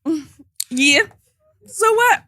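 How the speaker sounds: offhand then sharp high so what; calmed-down sass. Retold or imitated. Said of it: "they calm down and they're like".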